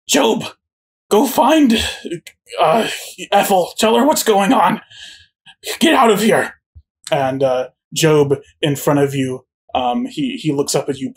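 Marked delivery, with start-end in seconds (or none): 0.1-6.5 high, shocked villain voice; panicked Maud. Acted. Maud panics as the cages open, high and shocked. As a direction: gasp out disbelief.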